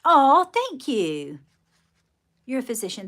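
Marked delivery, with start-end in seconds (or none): 0.0-1.4 drawn-out, high, gushing thank-you; a long, louder "oh" of real gratitude; touched thanks. Real, not acted. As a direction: gush your thanks slowly. Thanks a complimenting viewer.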